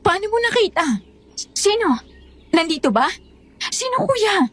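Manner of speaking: high, anxious questions; agitated, stammered demand for answers; urgent questioning. Acted. Urgently demanding the culprit's name.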